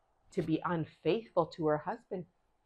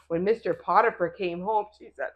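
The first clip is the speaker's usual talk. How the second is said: usual speaking voice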